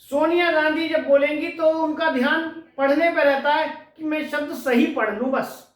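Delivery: ordinary delivery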